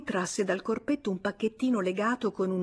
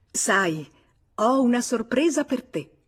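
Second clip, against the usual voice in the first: higher, louder, smiling tease; 5 semitones higher, 6 dB louder than usual. A narrator's reading. Madeleine tells her husband about a surprise, smiling. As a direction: dangle a happy surprise.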